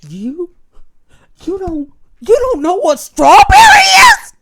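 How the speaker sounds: high, loud outraged yell; shouting. Acted. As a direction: shout it at him. Shouting skit character.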